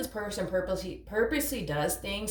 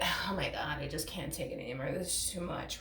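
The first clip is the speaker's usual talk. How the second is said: low, soft mock exasperation; overwhelmed imitation; 3 semitones lower, 8 dB quieter than usual. Voicing the partner who can't take it anymore, soft mock despair. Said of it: "sit here in this energy of".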